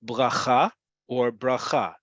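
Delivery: "Bracha" slow, rising to its final syllable; stress on last syllable. Shown as a demonstration. Teacher giving an example of Hebrew emphasis, "bracha" stressed at the end. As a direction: say "bracha" slowly with the weight on "cha". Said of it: "You see how it goes up at the end?".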